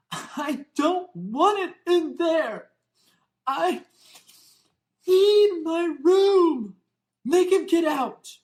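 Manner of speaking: bawling tantrum. A narrator's reading. Spoiled son bawling at his mother over the room. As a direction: wail it like a spoiled child in a tantrum. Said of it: "Dudleys bawling at his mother".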